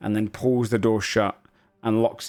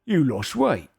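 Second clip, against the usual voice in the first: higher put-on ogre voice; curious ogre; 9 semitones higher, slower than usual. Ogre looking up at an old acquaintance, curious teasing voice. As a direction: tease him in an ogre voice. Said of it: "Duck kinda looks up curious and goes".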